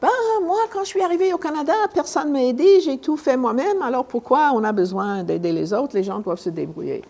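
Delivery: high mocking voice of someone refusing to help; mimicking complainers. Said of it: "j'ai entendu trop de personnes comme ça dire".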